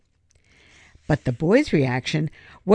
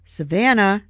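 usual speaking voice

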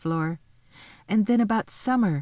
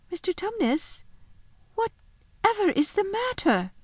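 much higher, tentative voice; concerned little girl; 12 semitones higher than usual. A narrator's reading. Girl sees faun in tears.

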